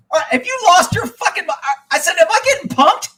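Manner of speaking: retold outraged yell; incredulous outburst.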